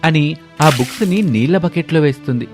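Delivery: plain reading voice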